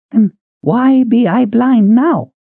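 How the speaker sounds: put-on slow, quavery old woman's voice; old Elda. Old Elda asking the big man who she is, slow and high. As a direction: ask it like a bewildered old woman.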